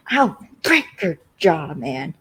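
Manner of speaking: mock-angry snap, punching the first words. [name] turns on Andre for piping up, snapping. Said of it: "he freaking snaps at Andre".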